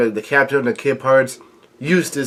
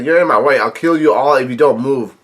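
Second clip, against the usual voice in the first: normal talking voice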